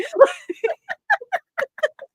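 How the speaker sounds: high squealing laugh; high laughter. Real, not acted. Big laugh.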